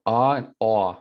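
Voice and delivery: "Ah" open and "aw" rounded, said carefully; vowel contrast. Shown as a demonstration. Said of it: "You hear that?".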